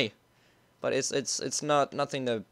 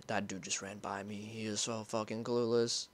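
much softer, low under-the-breath; hiding gamer muttering; hushed while hiding; 6 semitones lower, 13 dB quieter than usual. Real, not acted. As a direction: keep it down so he won't hear. Hiding from enemy in game.

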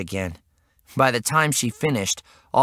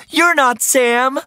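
high, loud, slow yell; mom's high-pitched outcry; 12 semitones higher, 5 dB louder, slower than usual. A narrator's reading. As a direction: yell it in shocked protest, drawn out. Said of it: "she yelled".

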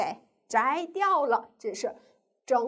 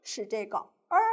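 ordinary talk, nothing marked

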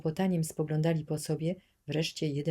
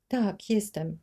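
sobbing; 6 semitones higher, 5 dB louder, slower than usual. A narrator's reading. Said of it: "zaszlochała dziewczynka".

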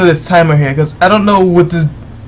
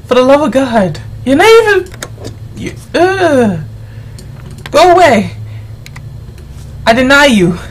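exasperated yelling; 5 semitones higher than usual. Real, not acted. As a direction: snap at him to go away. Yelling at a frustrating entrant.

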